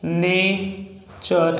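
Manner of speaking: नेचर drawn out very slowly; slow demo of नेचर. Shown as a demonstration. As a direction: stretch out नेचर for the class. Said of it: "next है नेचर".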